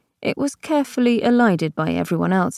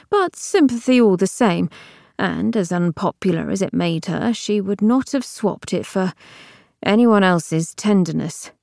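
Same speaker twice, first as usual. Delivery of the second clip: ordinary delivery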